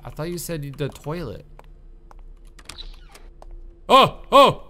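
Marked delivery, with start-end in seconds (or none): 3.9-4.6 sudden high loud startled oh; startled yelp. Real, not acted. Player jumps at a sudden shock, startled yelp. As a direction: jump at the scare.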